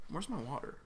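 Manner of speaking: ordinary talk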